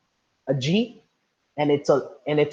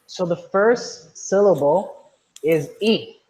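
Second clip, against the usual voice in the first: slow teaching; 3 semitones higher, much slower than usual. Shown as a demonstration. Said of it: "explaining syllables to class".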